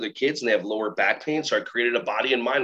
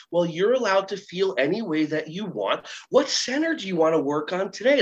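put-on higher-pitched teacher-to-child voice; lilting, raised pitch, talking to a child; upbeat teacher; 6 semitones higher than usual. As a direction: play an accommodating preschool teacher. Teacher calmly redirects child, acted.

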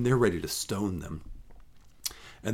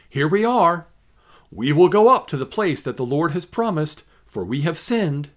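people's eager vow; 6 semitones higher, 6 dB louder than usual. Acted. People voiced in the reading.

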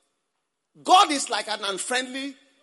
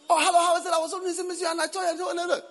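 high, clipped choppy talk; choppy chatter; 6 semitones higher, faster than usual. Retold or imitated. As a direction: rattle off short bits. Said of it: "staccato answers".

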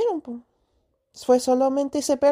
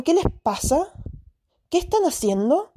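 higher scolding voice; scolding dogs; 5 semitones higher than usual. Retold or imitated. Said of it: "Lo empecé a retar". (retando a los perros con voz más aguda)

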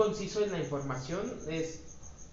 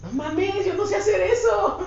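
high, loud disbelieving outburst; incredulous protest; 11 semitones higher, 7 dB louder than usual. Retold or imitated.

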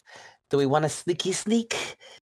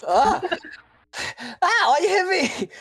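higher, squeaky hurt character voice; mock hurt yelp. Slapped protege complains to the robot, high yelp.

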